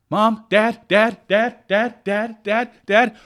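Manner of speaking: put-on high, insistent kid calling dad over and over; a child interrupting a parent on the phone, nagging; pestering child.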